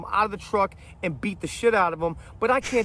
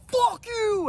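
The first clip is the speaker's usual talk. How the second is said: retold yell. Mike yelling back.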